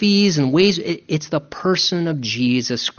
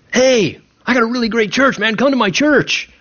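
higher-pitched, mock-enthusiastic invite; eager church promoter; 6 semitones higher than usual. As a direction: pitch it like a keen recruiter, raised voice. Acts out preaching church to people.

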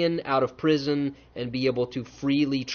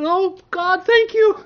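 much higher and louder, gushing with glee; overjoyed; 8 dB louder, slower than usual. Retold or imitated. A lucky man thanking God for found money, overjoyed. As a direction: burst out in happy thanks to God. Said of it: "You go".

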